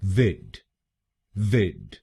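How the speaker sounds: विड as a short clipped "vid"; first syllable. Shown as a demonstration. Teacher modeling the first syllable: विड as a short vid. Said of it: "वीआईडी हम बोलेंगे".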